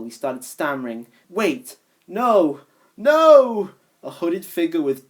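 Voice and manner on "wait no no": rising to a loud terrified no; frightened yell, much louder; very scared. Acted. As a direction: panic as it appears. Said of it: "very scared".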